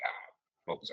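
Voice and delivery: ordinary delivery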